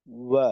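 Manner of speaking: ওয়া with lips rounded, a small gap, no closure; true waw. Shown as a demonstration. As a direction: model the right ওয়া. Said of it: "বা হয় না".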